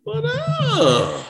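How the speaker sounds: drawn-out falsetto, playful; exaggerated high-pitched come-on; hammed-up flirty greeting. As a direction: stretch it out very high, mock-flirty. Acting out his flirty reply.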